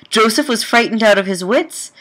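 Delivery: ordinary delivery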